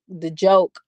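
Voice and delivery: ordinary talk, nothing marked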